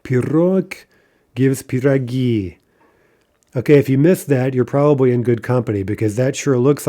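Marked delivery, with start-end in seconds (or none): 0.0-2.6 "Pirog" as pi-ROG, then "piragi" as pi-ra-GI; stress on final syllables. Shown as a demonstration. Teacher modelling a new noun, "Pirog", "piragi" stressed on the last syllable. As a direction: slowly land the end of "Pirog" and "piragi". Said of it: "a few more, uh, in-stressed".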